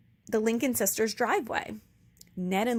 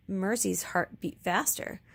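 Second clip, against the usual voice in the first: ordinary delivery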